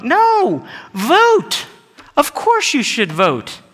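very high, drawn-out correction; emphatic, exasperated-sounding cry; emphatic exclamation. Real, not acted. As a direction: call it out as if obvious. Emphatic answer to retold question.

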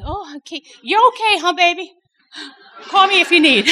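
high, loud and laughing, half teasing; playful sing-song to a daughter; mock-sweet reassurance. Retells her own reply while tired, laughing.

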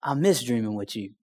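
God gently speaking inside; still small voice. Retold or imitated. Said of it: "still small voice said".

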